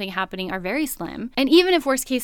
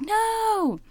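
high, drawn-out cry of dismay; mock movie-goer's cry; 11 semitones higher than usual. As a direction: plead with the girl on screen, hammed up. Yelling at scary movie character.

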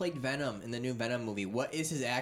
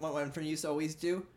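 ordinary delivery

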